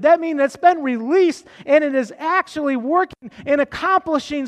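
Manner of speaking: fervent sermon delivery; preaching. Acted. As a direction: drive the point home to the congregation. Preaching to the church.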